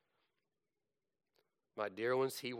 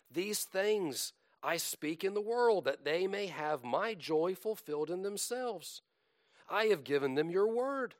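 high, loud pleading declamation; fervent recited prayer; 8 semitones higher, 6 dB louder than usual. Acted. Jesus praying to his Father for followers, as preached fervently. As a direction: pray it out with lifted, carrying pitch.

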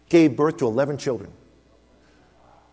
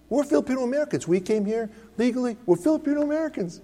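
mimicking congregants; 9 semitones higher, 3 dB quieter, faster than usual. Mimicking Filipino church members.

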